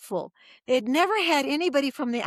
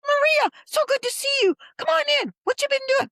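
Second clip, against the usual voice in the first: high, loud, gushing welcome; delighted guard greeting; 5 dB louder, faster than usual. Retold or imitated. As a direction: greet her like a guard thrilled to see an old friend. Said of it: "the g-, guard said".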